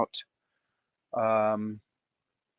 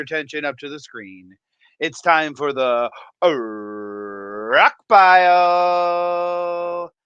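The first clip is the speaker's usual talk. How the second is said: slow, drawn-out, higher showman announcer; welcoming fish keepers to the rock pile, announcer style; announcer intro; 4 semitones higher, 5 dB louder, much slower than usual. Acted. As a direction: hype the crowd like a ringmaster. Show-opener announcer intro.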